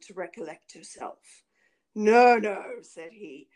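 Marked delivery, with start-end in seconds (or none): 1.9-2.8 loud, emphatic denial; stern refusal. A narrator's reading. Bluebeard refuses his wife a last moment, loud stern refusal. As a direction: cut off the plea forcefully.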